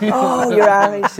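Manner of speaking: high, slow, delighted "oh" of a French local; delighted surprise. Retold or imitated. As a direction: stretch it out, charmed to meet an Irishman. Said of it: "And so people say".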